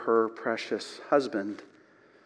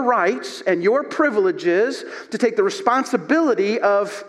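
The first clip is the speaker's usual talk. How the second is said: louder, faster fervent preaching; preaching at full force; 6 semitones higher, 4 dB louder than usual. Acted. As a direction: drive the point home to the congregation. Preaching exhortation.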